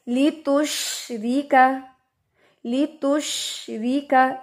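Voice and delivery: लितुशरिका recited slowly with a thin रि; tajweed recitation. Shown as a demonstration. Teacher recites the example for students, slow tajweed. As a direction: chant the Quran word slowly. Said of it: "रिका, रिका नहीं हम पढ़ेंगे इसको".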